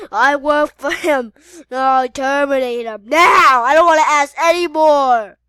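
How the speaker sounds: loud, forceful order; impatient villain shouting; villainous command. Acted. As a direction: bark the kill order. Villain loudly orders a kill.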